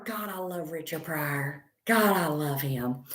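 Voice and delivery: low, soft, reverent aside; heartfelt admiration. Real, not acted. Comedian confiding her love of Richard Pryor, heartfelt admiration. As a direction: drop low and softly mean it.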